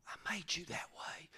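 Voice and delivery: soft whisper as the Father. Acted. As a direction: breathe it tenderly. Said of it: "God's whispered line".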